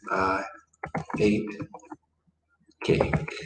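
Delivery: I ... ate ... cake, each word set apart with long pauses; slow, word by word. Shown as a demonstration. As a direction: spell out "I ate cake" one word at a time, slowly. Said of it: "So what would that be?".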